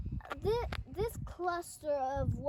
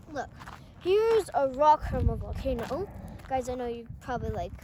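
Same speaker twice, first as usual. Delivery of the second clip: ordinary talk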